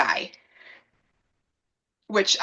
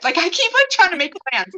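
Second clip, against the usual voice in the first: high, raised-voice mock complaint; exasperated protest; 8 semitones higher, 4 dB louder than usual. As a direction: complain loudly, pitched right up. Exasperated protest over plans.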